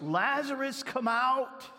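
high, commanding shout; loud call. A narrator's reading. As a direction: call out as if summoning someone from a tomb. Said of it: "called in a loud voice".

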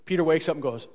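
usual speaking voice

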